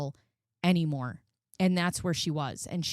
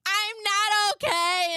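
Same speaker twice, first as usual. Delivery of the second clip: high, slow, crying voice; telling the doctor she is not okay, crying; tearful; 14 semitones higher, 4 dB louder, slower than usual. Retold or imitated. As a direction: say it through tears, breaking. Retelling breakdown to doctor.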